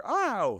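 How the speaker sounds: high mock cry of "ow"; acted yelp of pain. Teacher imitating the next shock's victim, mock pained yelp. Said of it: "then hear".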